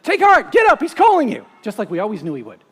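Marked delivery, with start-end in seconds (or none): 0.0-1.4 eager call. Acted.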